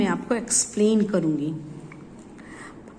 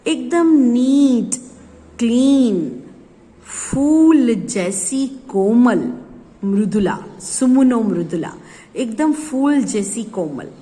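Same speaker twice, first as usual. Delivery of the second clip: tender; 3 dB louder, slower than usual. Acted. Describing the child tenderly.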